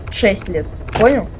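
ordinary delivery

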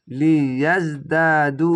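লিয়াজদাদু very slow, the final দু held three counts; slow recitation with a long madd on দু; slow drawn-out recitation. Shown as a demonstration. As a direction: stretch the last vowel long. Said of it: "তিন আলিফ টেনে পড়বেন".

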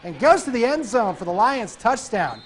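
normal talking voice

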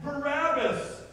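high, loud, stretched-out shout; crowd shouting back. Acted. Crowd naming the prisoner to release, shouting back. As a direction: shout the name back, slow and forceful. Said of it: "the crowd shouted back".

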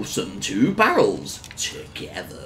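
slow, playful stretched-out phrase; playfully drawn out. Pretend. Player playfully naming two barrels, slowly drawn out. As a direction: stretch it out slowly for comic effect. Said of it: "I have to say it like that".

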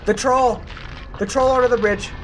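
startled yelp at the troll; sudden scared cry; alarmed. Real, not acted.

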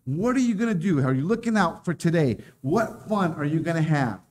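usual speaking voice

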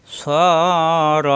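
long rounded "স", short "র"; one-alif madd. Shown as a demonstration. Teacher repeats joined word, held "স" then short "র". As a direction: hold "স", then a brief "র". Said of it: "এক আলিফ টান".

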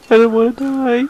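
high, loud cry of alarm; panicked. Real, not acted. Player who just found a skeleton, panicked high plea. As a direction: blurt it high after a gasp. Fears dying in the game.

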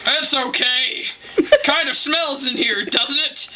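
drawn-out, casual dad voice, put on; imitated dad. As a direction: drag it out as an unbothered dad. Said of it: "And then Dad was like".

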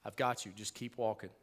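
soft, hushed and tender; a soft reassuring voice for God; gentle reassurance. Retold or imitated. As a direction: speak as God comforting a child, quietly. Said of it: "And God's like".

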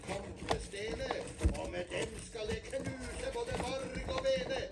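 loud, high battle cry; booming challenge. Acted. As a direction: shout it as a threat. Big goat challenges troll.

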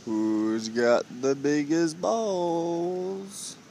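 very slow, stretching every word; drawn-out taunt. Real, not acted. Man taunting seagulls over a french fry, slow drawn-out call. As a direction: drag it out like a long teasing call.

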